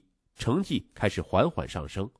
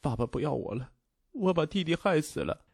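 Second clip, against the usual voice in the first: put-on soft, higher-pitched timid child; 5 semitones higher, 4 dB quieter than usual. A narrator's reading. Boy tells his stepmother he caused the death, timid and soft. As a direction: say it timidly, in a small hurt voice. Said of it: "才怯怯地说".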